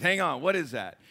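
loud, high preacher's opening; preaching at full force. Acted. Preacher telling the congregation a story, loud and high.

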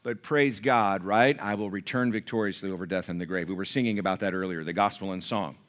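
low, softened words of praise; reverent preaching. Real, not acted. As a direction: bring it down low in quiet reverence.

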